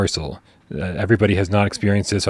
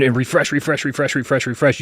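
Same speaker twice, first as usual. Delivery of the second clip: the same word rattled off in an even chain; repetitive rattle; 3 semitones higher than usual. Acted. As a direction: mimic someone refreshing over and over. Acts out frantic repeated page refreshing.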